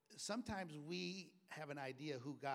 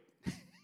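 a sudden high-pitched laugh; delighted high laugh; 5 dB louder than usual. Real, not acted. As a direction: crack up at the story. Laugh after quote.

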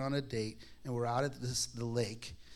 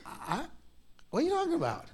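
baffled protest; 13 semitones higher, much faster than usual. Retold or imitated. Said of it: "I said".

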